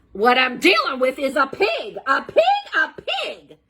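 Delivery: high, loud indignant cries; outraged shout. Acted. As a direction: spit out the word pig in fury. Eugenia outraged on the phone.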